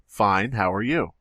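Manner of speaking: usual speaking voice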